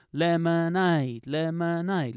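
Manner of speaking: slow lemonade, last syllable held; rhythmic lemonade. Shown as a demonstration. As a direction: chant lemonade in time. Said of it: "Let's just practice that".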